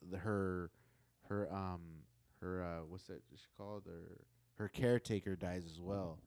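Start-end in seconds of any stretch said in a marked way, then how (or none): none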